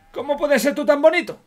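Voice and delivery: high, loud, delighted gush; gushing over cute dog. Real, not acted. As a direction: squeal it at the cute puppy.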